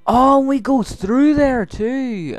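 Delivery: high, loud, drawn-out cry; excited exclamation. Real, not acted. Player watching where the game goes, excited exclamation. Exclaims at game's opening scene.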